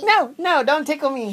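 high mock protest while tickled; playful high plea to stop; being tickled. Father being tickled by child.

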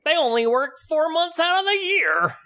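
high mocking politician voice; mocking Republicans. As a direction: sneer it as a pundit. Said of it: "what the Republicans, uh, said".

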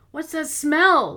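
loud, high, slow cry; alarmed cry. Acted. Choking smoke fills the hallway.

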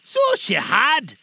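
high, loud, slow exclamation; emotional high-pitched cry. A narrator's reading. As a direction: cry it out as old Scrooge, drawn out. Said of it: "cried Scrooge".

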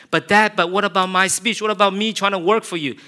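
high, loud pleading; pleading son. Acted. Son begging his father to hear his speech, high pleading. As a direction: beg your dad to listen.

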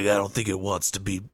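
low, dry mock voice; mock deadpan reply. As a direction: drop the voice for a dopey answer.

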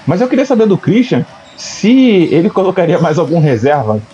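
ordinary talk, nothing marked

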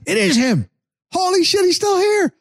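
spotting the prize at last; excited realization. Retold or imitated. Spotting the podcast guest across the field, high excited disbelief. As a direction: pitch leaps high, thrilled.

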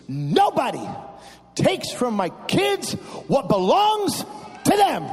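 slow, building to a loud high end; mom's vow, rising to a shout; firm declaration. Retold or imitated. Mom declaring to her kids in the van, slow and rising. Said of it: "She says".

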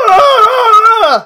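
high, loud cartoon scream; a dramatic panicked scream. Phineas screams at the news.

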